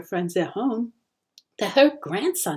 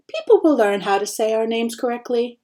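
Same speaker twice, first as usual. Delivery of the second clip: usual speaking voice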